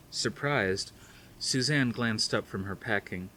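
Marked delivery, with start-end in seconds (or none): none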